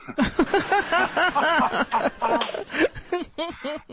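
a long laugh pitched well up; long high laugh. Real, not acted. Host cracking up at his own census joke, long high laugh.

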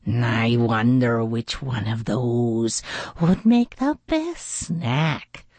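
slow, sly weasel voice; Snout scheming. A narrator's reading. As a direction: muse slowly like a hungry villain. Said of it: "he thought".

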